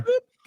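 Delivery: high boop. Pretend. Playful sound while clicking.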